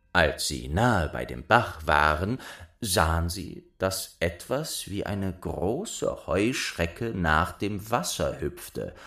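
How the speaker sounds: usual speaking voice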